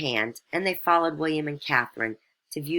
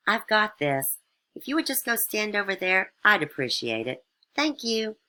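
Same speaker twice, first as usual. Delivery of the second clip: a higher, lightly voiced condescension; condescending; 8 semitones higher than usual. A narrator's reading. Megan brushes off the aide, a lightly voiced condescension. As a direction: brush the aide off sweetly but high-handed. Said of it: "Megan said condescendingly".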